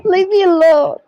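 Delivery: loud, high-pitched protest; high, loud plea to back off; flustered outburst. Real, not acted. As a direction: cry out to be left alone. Flustered player snaps at teasing.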